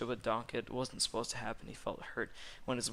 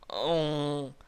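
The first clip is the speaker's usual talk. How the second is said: loud, drawn-out, higher moan; hammed-up long moan; theatrical moan; 4 semitones higher, 7 dB louder than usual. A narrator's reading. The Big Bad Wolf moaning, exaggerated and loud. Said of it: "The Big Bad Wolf moans".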